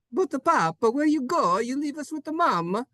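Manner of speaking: put-on high pleading child with Italian accent. Italian child pleads with departing papa.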